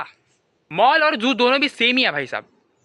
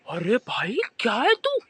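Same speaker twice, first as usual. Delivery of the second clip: deadpan disbelief; 3 dB quieter, slower than usual. Acted. Reacting to friend's stupidity.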